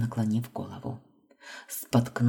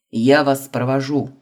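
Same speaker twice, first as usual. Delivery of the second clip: loud booming reply; 7 dB louder than usual. A narrator's reading. Booming dean replies.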